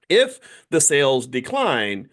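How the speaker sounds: ordinary talk, nothing marked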